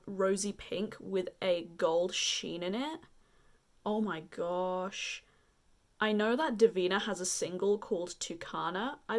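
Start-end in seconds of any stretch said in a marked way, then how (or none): 3.8-5.2 awed. Real, not acted. Awed by a swatch.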